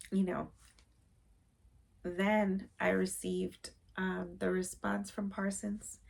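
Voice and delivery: ordinary delivery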